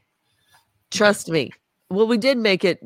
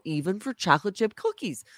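normal talking voice

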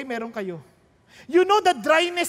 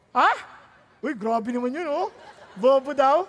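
ordinary delivery

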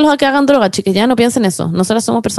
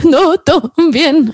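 slow, drawn-out "todo bien"; mock-calm while still teary; slow reassurance; 5 semitones higher, much slower than usual. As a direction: stretch it out to calm them. Insisting all is fine while crying.

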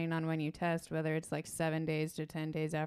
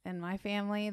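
normal talking voice

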